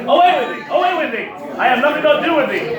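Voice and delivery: loud, high theatrical rebuff; indignant dismissal. Acted. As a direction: send them packing with stagey outrage. Acted dismissal of the petitioners.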